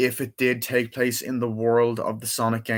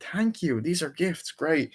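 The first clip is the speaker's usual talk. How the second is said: high-pitched thanks, gradually easing down; imitated grateful cartoon hero; 7 semitones higher, slower than usual. Acting out Sonic receiving gifts.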